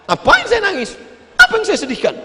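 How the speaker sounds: high-pitched, incredulous questioning; acted scoffing comeback; indignant retort. Acts pilgrim scoffing at question.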